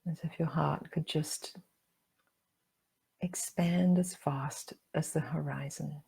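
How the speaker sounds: calm meditation guide with long pauses; guided meditation. Acted. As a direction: guide them gently inward, leaving room to breathe.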